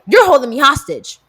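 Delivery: loud accusing complaint; mock indignant. Repeated complaint.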